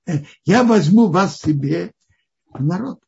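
ordinary talk

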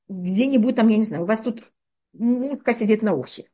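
normal talking voice

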